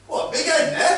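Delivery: loud. Acted. Bramble calls out.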